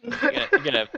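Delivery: high breathless laughing words. Real, not acted. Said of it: "laughing at Kiwi attempt".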